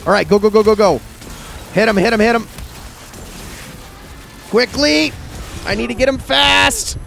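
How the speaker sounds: high, loud, frantic urging; cheering a fight. Real, not acted. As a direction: urge the shots on, all excited. Frantic boss fight calls.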